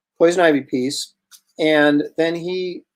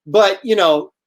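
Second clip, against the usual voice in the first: put-on drunk admirer making excuses, raised voice; drunk fan; 5 semitones higher than usual. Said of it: "and the guy's drunk".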